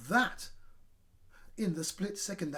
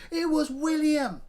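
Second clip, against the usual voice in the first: high, loud shout; shouting blame; 10 semitones higher, 9 dB louder than usual. A narrator's reading. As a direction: point the finger loudly. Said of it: "shouted horrid Henry".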